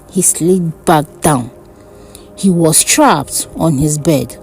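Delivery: ordinary delivery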